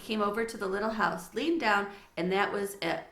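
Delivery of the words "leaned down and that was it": ordinary delivery, nothing marked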